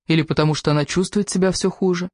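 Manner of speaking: plain reading voice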